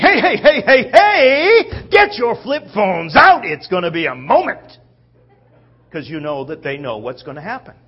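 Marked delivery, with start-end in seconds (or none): 0.0-4.8 loud, high rallying shouts, easing at the end; rally everyone to watch the spectacle; excited crowd calling. Acted. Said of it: "So they're saying".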